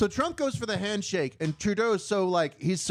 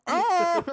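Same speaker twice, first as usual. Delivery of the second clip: sudden high loud yelp; laughing shout; 12 semitones higher, 5 dB louder than usual. Real, not acted. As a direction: blurt out a loud high cry. Laughing outburst while watching.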